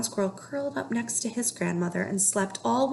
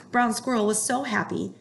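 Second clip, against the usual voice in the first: plain reading voice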